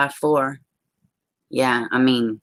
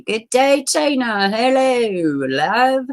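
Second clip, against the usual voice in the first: put-on higher-pitched, playful Southern Irish accent; 7 semitones higher, much slower than usual. Greeting a viewer in the chat, mock Southern Irish accent. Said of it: "that's my Southern Irish accent".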